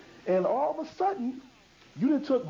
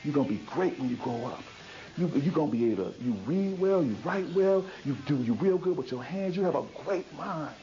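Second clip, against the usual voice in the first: put-on praising a child; 7 semitones lower than usual. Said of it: "feed the mind of one of them saying".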